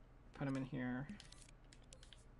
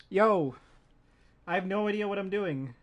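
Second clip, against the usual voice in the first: excited shout; 6 semitones higher, 11 dB louder than usual. Real, not acted. Excited reaction while building.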